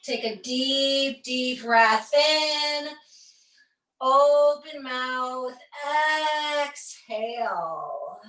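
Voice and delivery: very slow, drawn-out guiding voice; long held vowels, easing down on the exhale; slow breath cue. Real, not acted.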